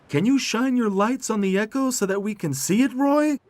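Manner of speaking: very high, loud cartoon question; eager tractor Tech.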